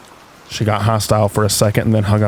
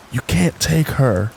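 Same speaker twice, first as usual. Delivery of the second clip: slow, higher-pitched retold yell; mock yell, held back; 4 semitones higher, slower than usual. A narrator's reading. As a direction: draw it out like a restrained yell. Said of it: "while a guy yells".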